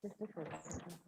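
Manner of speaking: faint, far from the mic; distant, too quiet to hear; barely audible. Real, not acted. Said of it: "Your voice is very low".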